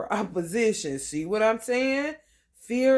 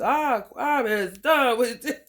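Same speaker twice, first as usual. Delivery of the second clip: louder exasperated cry with a laugh; fed-up outburst, laughing; 5 semitones higher, 7 dB louder than usual. Retold or imitated. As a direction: blurt it out like you've had enough. Voicing a fed-up Aries.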